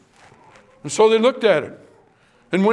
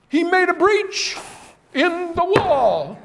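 much higher, drawn-out and slow; weighty emphasis; 8 semitones higher, slower than usual. Real, not acted. Preacher warning the congregation, slow and high. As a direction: dwell on "breach" and "wall" to sink it in. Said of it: "emphasis on the breach".

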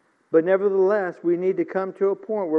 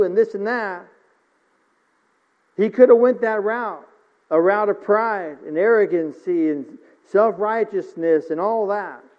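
ordinary talk, nothing marked

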